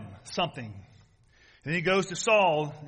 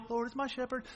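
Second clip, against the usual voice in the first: very soft, dainty mock recital; mock it as sweet and harmless; pretty little recital; 5 semitones higher, 9 dB quieter than usual. Preacher mocks the pretty version of David's story, soft dainty recital. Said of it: "It wasn't a pretty little".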